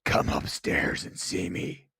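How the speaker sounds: low breathy ghostly voice; haunting voice. Retold or imitated. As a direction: lure them upstairs, eerily. Imitating film ghost voice.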